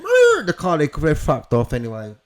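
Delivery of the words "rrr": loud high engine imitation; mouthed screech of a getaway car; revving engine noise. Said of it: "they've gone".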